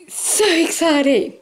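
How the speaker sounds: high, slow squeal of delight; giddy Halloween excitement, dragged out; crazy excited. Shown as a demonstration. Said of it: "Reason why I'm talking like this, going crazy".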